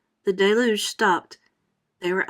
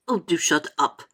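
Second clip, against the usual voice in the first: lower, tired rebuke; weary grasshopper; 4 semitones lower than usual. Acted. Grasshopper to the singing centipede, low weary rebuke. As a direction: brush him off wearily. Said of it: "the old green grasshopper said".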